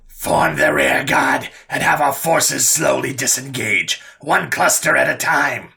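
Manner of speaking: slow, forceful raspy orders; deep, raspy overlord. A narrator's reading. Overlord issuing commands to the lone warlord, raspy commanding voice. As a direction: issue each order in a rasping overlord's voice. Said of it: "her deep and raspy voice resounded".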